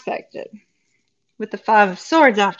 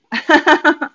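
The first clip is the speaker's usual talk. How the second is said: laugh; 6 semitones higher, 5 dB louder than usual. Real, not acted. Laughing at the cards.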